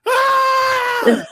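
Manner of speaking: high, loud imitated scream; retold scream. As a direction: let out a sudden high-pitched mock shriek. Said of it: "all I hear is".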